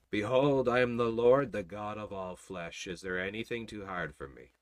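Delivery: low, hushed, majestic voice of God; God's voice. Acted. Preacher voices God speaking.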